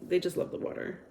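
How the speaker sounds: a secretive whispered aside. Shown as a demonstration. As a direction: repeat it in a soft whisper. Whispered repeat of the same line.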